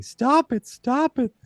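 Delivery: mock freak-out in a high voice; high-pitched yelling. Retelling Bill freaking out and yelling, high-pitched. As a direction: squeal it high, without full volume. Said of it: "Like, high pitched".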